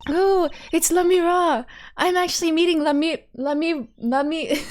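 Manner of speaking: high, excited fan voice; starstruck excitement. Acted. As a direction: gush like a fan meeting an idol. Voices a starstruck fan meeting singer.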